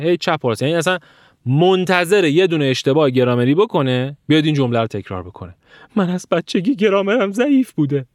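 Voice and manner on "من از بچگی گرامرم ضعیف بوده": high, wavering put-on whine; mocking whiny student. Student repeating an excuse after one mistake, mock whine. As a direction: mimic a self-pitying learner's excuse.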